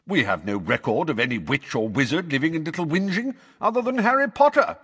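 high, formal objection; challenging court witch. Acted. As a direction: raise the pitch as you challenge.

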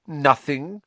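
lofty. A narrator's reading. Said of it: "in a lofty voice".

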